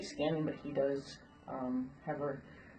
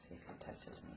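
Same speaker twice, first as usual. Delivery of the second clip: whispering; 19 dB quieter than usual. Real, not acted. Quiet aside near horse.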